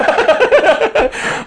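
a burst of high laughter. Real, not acted. Preacher laughing while retelling the story, high burst.

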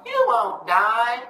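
slow, drawn-out, high devil voice. Retold or imitated. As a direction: stretch it out like a teasing tempter. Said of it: "the devil's talking like that".

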